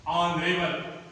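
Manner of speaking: usual speaking voice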